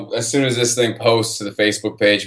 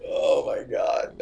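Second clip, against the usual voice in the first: breathless whisper; 8 semitones lower, 7 dB quieter, much slower than usual. Real, not acted. Awed reaction.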